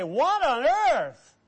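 slow, drawn-out what on earth; mock astonishment. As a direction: stretch it in shocked disbelief. Pastor reacting to rumor.

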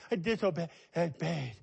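words broken by sobbing gasps; imitated sobbing child. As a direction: mimic a little girl talking through sobs. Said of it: "she's crying".

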